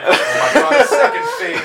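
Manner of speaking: laughing. Real, not acted. Reacting to a second boss phase.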